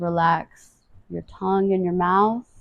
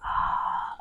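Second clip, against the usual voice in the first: whispery soft sigh; audible exhale; 10 dB quieter than usual. Shown as a demonstration. Guide breathes with the stretch, whispered sigh.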